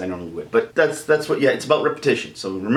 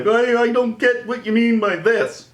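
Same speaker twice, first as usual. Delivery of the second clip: high, slow cartoonish voice; mock puzzled viewer; 11 semitones higher, slower than usual. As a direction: imitate a confused follower in a high put-on voice. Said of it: "If you say, like".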